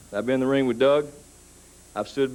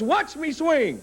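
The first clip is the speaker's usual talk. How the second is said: put-on kid's boast; 13 semitones higher, much slower than usual. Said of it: "And he says".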